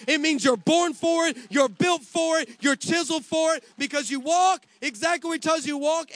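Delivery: loud, high, driving sermon climax; preaching at full force. Acted. Pastor preaching hard to his congregation, loud and driving. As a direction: preach it out to the whole church.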